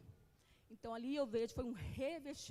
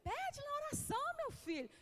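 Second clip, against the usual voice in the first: mother's advice; 12 semitones higher than usual. Retold or imitated. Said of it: "Você fala assim".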